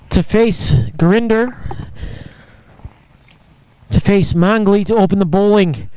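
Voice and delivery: usual speaking voice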